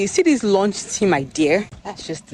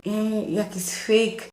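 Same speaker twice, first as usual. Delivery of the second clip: higher, softer doubting voice; mock sceptics; 4 semitones higher, 6 dB quieter, slower than usual. Vlogger quoting online doubters, mock sceptical. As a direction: imitate people scoffing at her. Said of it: "Some people say".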